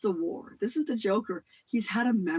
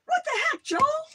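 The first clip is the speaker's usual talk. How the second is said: very high, louder put-upon yelp; mock angry neighbour; 6 dB louder than usual. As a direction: squeal it like a startled dorm mate. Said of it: "He'd come out, say".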